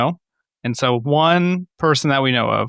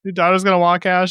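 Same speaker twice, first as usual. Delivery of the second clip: usual speaking voice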